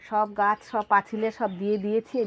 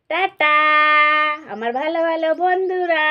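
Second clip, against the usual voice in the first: cheery goodbye; 6 semitones higher, 6 dB louder than usual. Real, not acted. Saying bye to child viewers.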